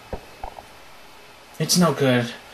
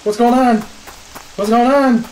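loud high bewildered cry; panicked high cry; 9 semitones higher, 7 dB louder, much slower than usual. Real, not acted. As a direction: shout it out of breath. After escaping, panicked.

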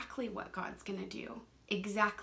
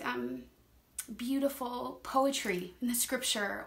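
usual speaking voice

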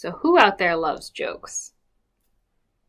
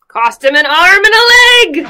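higher, loud punchline; punchline shout; 12 semitones higher, 12 dB louder than usual. Acted. Miss Julie shouts the punchline to the children. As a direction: land the joke with a shout.

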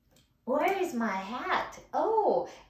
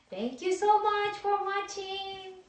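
cheery sign-off; 9 semitones higher, 4 dB louder, much slower than usual. Real, not acted.

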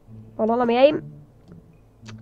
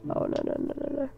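panicked whisper; 3 semitones higher, 14 dB quieter, faster than usual. Real, not acted. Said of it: "I'm literally freaking out right now".

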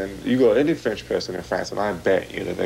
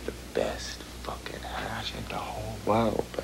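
conspiratorial whisper about the hash; hushed whisper; 10 dB quieter, slower than usual. Acted. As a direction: whisper it slow like a secret. Boasting about Moroccan hash.

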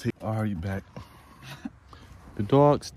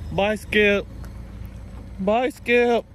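calling goodbye; 9 semitones higher, much slower than usual. Real, not acted. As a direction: wave the dog off, calling after him. Farewell shouted to the dog.

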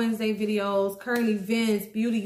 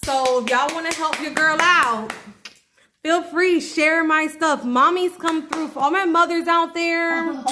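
louder and higher, hyping up the viewers; rally your audience with energy; excited shout-out; 6 semitones higher, 6 dB louder than usual. Real, not acted. Vlogger's loud shout-out to viewers.

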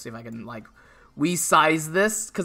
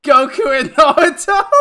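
loud, high, laughing greeting; 6 semitones higher, 10 dB louder than usual. Real, not acted. Streamer greeting a viewer while cracking up, loud laughter. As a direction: talk through giggles, much louder and higher.

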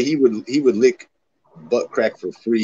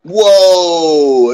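surprised whoa; 7 semitones higher, 8 dB louder than usual. Real, not acted. Shocked streamer hears of daughter.

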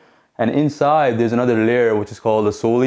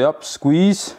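energetic cue; 6 semitones higher than usual. Real, not acted. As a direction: pitch jumps up on squeeze. Coach cueing the top squeeze.

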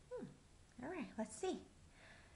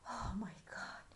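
whisper; 5 semitones lower, 6 dB quieter than usual. Acted. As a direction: breathe it out in a shocked whisper. Awed aside.

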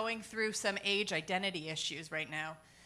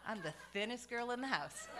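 ordinary talk, nothing marked

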